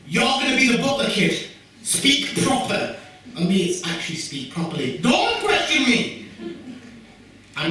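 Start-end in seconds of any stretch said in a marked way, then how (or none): 0.1-3.0 mum's bossy order. Retold or imitated. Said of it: "you know the butler character". 5.0-6.3 high, slow, laughing mock outrage; mock indignant snap. Mother scolding her son for correcting her, mock outrage.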